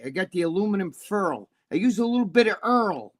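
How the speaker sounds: hammed-up Bronx Brooklyn accent, r-colored vowels; Brooklyn accent imitation. Mocking his friend Jimmy's Brooklyn way of talking, Brooklyn accent. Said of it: "making fun of him 'cause he'll say".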